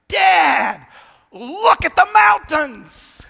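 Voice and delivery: put-on high, loud childlike cry of wonder; little son shouting from the plane window; excited kid shout. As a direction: shout it out like an amazed little boy. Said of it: "and he goes".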